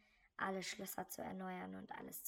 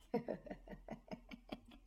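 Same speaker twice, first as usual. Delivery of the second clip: whispery laugh; 4 dB quieter than usual. Acted. Laughs after scare.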